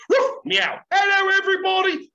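high, loud silly animal-noise greeting; attention-grabbing call. Shown as a demonstration. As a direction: break the pattern with a loud, goofy high call. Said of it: "where I teach you go".

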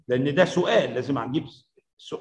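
ordinary delivery

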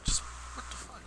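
breathy whispered disbelief; whispering. Real, not acted. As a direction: whisper it under your breath. Hushed aside during game.

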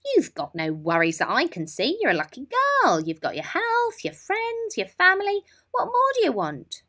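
a mum losing patience, rising to a peak; exasperated mum. Acted. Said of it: "Mum would probably say".

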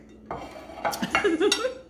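airy high giggle; a breathy, sneaky laugh. Real, not acted. Teasing viewers about not sharing, airy giggle.

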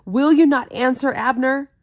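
calling out. Acted. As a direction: shout it over to the far camp. David calling out to army.

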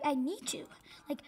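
ordinary delivery

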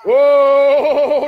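long, loud held "oh"; exultant "oh". Real, not acted. Preacher exulting over the blessed family, drawn-out whoop. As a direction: belt out a sustained preacher's whoop.

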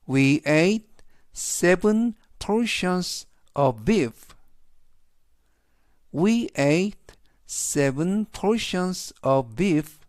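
We ate seven portions of beef, read very slowly; slow classroom model sentence; slow teaching read. Shown as a demonstration. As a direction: read it slowly for students to repeat. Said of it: "천천히 세 번씩 읽어드리고요".

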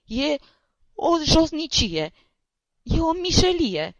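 put-on a wounded young lawyer bursting into tears; sobbing outburst. A narrator's reading. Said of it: "izbucni din pieptul lui un hohot de plâns".

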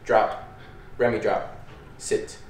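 firm command tone. Shown as a demonstration. Trainer switching from praise to a low, firm release command. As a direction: drop the praise and turn firm. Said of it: "my tone is gonna change".

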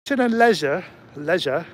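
imitated Australian "leisure", said LEZH-uh; Australian accent. Driver quoting his Australian passenger, imitated Australian accent. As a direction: say it as an Australian tourist would. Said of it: "he sounded Australian".

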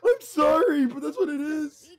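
laughing to tears. Real, not acted. Streamer apologizing through tears of laughter. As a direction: apologize while crying with laughter.

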